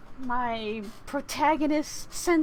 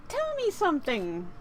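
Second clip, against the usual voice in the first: normal talking voice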